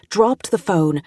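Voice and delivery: plain reading voice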